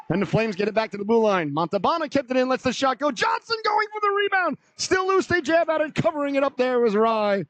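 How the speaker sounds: high-pitched urgent call, peaking on the rebound; excited play-by-play. Real, not acted. Commentator calling a scramble for the rebound, high and excited. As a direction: surge up high, then start coming down as play is smothered.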